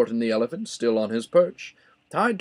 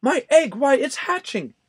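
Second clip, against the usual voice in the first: higher, louder thrilled cry; excited shout; 11 semitones higher, 4 dB louder than usual. A narrator's reading. Horton watching the egg crack, excitedly shouting. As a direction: burst with amazement.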